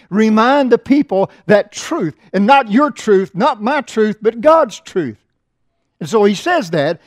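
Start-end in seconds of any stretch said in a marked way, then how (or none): 0.1-5.2 loud and slow, each truth driven home; rising pulpit fervor; preaching emphasis. Acted. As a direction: hammer the point to the church.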